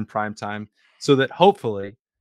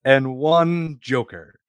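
grand announcer; 6 semitones higher, 6 dB louder than usual. Pretend. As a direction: proclaim it to the whole empire, pitch soaring on key words.

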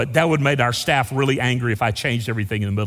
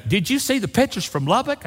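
higher-pitched retold question; someone's question; 8 semitones higher than usual. Someone asking the preacher about snow pictures, mimicked curious question. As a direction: lightly mimic a curious acquaintance. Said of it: "Someone said to me today".

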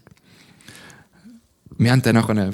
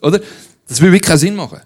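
ordinary delivery

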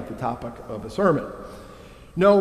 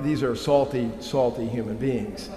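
usual speaking voice

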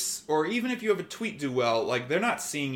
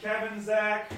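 calling out names, pitched up; yelling from back; 5 semitones higher, slower than usual. Real, not acted. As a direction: call to them across the room. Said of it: "I'm gonna yell from back here".

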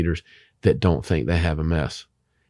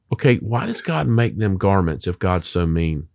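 normal talking voice

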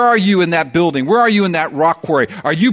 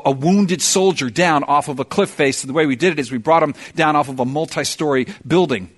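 ordinary talk

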